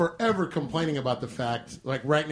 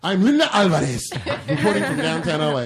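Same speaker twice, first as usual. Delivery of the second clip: put-on newscaster sign-off that leaps into a Spanish accent on the name; Linda Alvarez; 6 dB louder than usual. Anchor signing off from downtown, hammed-up newscaster accent. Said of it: "it's the newscaster accent".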